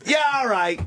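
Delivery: hammed-up English copper; British bobby accent. Bobby answering the Toledo tourist in British accent. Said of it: "cute accent".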